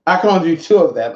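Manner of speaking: ordinary talk, nothing marked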